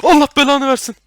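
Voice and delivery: very loud, high curse; furious shout. Real, not acted. Gamer cursing a monster, furious yell.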